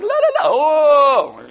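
high singsong la-la-la from a donkey wandering off; carefree donkey. Acted. Donkey ignoring Balaam, wandering off, high carefree singsong. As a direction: sing it out high and careless like a strolling donkey. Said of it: "So the donkey's like".

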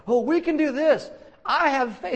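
high, loud, over-keen believer; hammed-up rallying cry; mock zealous optimism. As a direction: belt it out like an irritatingly sure optimist. Imitates irritating faith enthusiast.

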